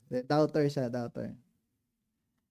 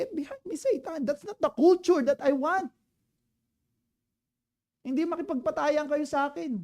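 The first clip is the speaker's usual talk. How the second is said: much higher, mock-urgent rebuke; retold rebuke; 9 semitones higher, 3 dB louder than usual. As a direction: pitch the rebuke high and insistent. Jesus's rebuke retold again.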